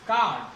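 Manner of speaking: कार्ड said as "kaad", no R; long "aa" straight into D in कार्ड; silent R. Shown as a demonstration. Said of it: "तो आर का उच्चारण नहीं होगा". (कार्ड को "काड" बोलें, र चुप)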